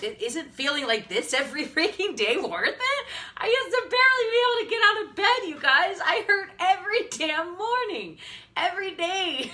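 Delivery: high, loud, choked-up plea; real tearful outburst. Real, not acted. Telling viewers how she hurt every morning, tearful. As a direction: voice climbing as the tears break through. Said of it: "will I ever do a live video without crying".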